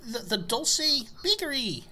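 put-on high eager kid voice; bright little delivery boy; young elf kid. Plays young elf Dipley.

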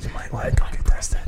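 whisper. Real, not acted. Whispered prompt to caller.

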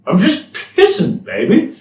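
high, drawn-out southern drawl; mock a thick southern girl; exaggerated Southern US accent. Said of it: "in the most southern fucking accent of all time".

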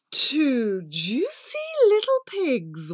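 slow, savouring wolf voice; gluttonous wolf, pleased with himself; relishing wolf. Acted. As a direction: draw out the words with greedy relish. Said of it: "Wolf cried".

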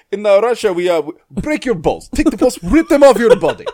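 put-on loud laughing Russian accent menace; hammy high Russian threat, laughing. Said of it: "It's like".

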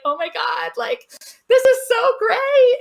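high, slow, loud gush; thrilled viewer reacting to the TV; excited. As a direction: squeal with delight, drawn out. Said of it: "see that on T V and be like".

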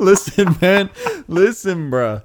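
loud, insistent listen bro; mock pleading voice. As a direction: plead like the friend. Impersonating Joel's excuse.

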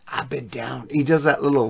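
ordinary delivery

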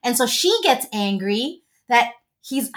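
ordinary talk

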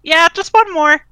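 loud, high reply to another room; calling back. Acted. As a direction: holler an answer back to Dad. Calling back to father in kitchen.

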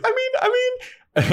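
squeaky high stammer; embarrassed mother stammering; flustered mom. Retold or imitated. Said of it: "and Joyce like".

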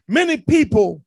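ordinary delivery

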